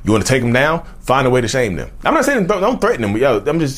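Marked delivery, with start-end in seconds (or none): 2.0-3.2 jumps much higher and a bit louder; sudden high pitch. Real, not acted. To viewers, a sudden high-pitched backpedal. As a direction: leap up high to walk it back.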